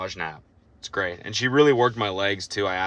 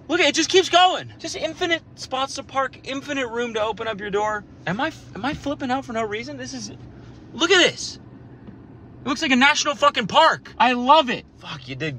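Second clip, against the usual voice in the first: much higher, loud giddy raving; excited gushing; 5 dB louder than usual. Real, not acted. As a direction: gush over it breathlessly. Raving about parking lot.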